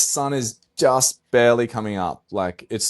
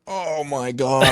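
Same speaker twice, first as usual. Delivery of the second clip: drawn-out, higher-pitched oh-my-God; retold awe; 5 semitones higher, slower than usual. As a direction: relive the shock of seeing the wave. Awed at a huge wave.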